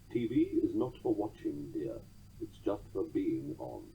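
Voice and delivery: languid drawl, slowing and sinking low; lightly voiced languid wit; Noel Coward drawl. A narrator's reading. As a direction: drawl it softly, trailing down. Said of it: "Sir Noel Coward drawled".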